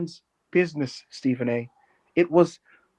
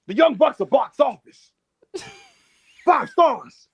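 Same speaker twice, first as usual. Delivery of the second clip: higher TV-pundit hype; mock Stephen A praise, pitched up; 8 semitones higher, slower than usual. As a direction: sell them like a sports-show hype man. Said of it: "Stephen A's just like".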